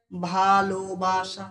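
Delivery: ভালোবাসা slowly stretched as ভা-লো-বা-সা; syllable by syllable. Shown as a demonstration. Teacher counting syllables for pupils, ভালোবাসা said slowly in parts. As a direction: draw out every syllable of ভালোবাসা. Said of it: "প্রথমে ভা".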